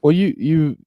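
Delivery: ordinary talk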